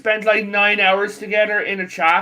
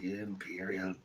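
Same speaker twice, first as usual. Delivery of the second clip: much lower, quiet gravelless grumble of Imperial; deep mock voice; 13 semitones lower, 21 dB quieter, slower than usual. Friend mocking the Nords with a low, quiet voice. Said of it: "I'm like".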